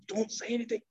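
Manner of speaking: soft retold hush. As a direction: quietly imitate telling a companion to keep silent. Prophet retold hushing Umar.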